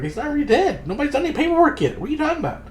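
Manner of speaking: very high-pitched incredulous voice; mock disbelief. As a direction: squeak out baffled objections. Acted disbelief at running joke.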